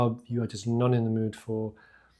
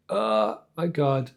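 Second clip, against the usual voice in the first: drawn-out groan of dismay; slow, weary "oh my God"; mock dismay; slower than usual. Imagined worker realising the evening is gone, mock dismay.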